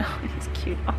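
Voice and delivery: ordinary talk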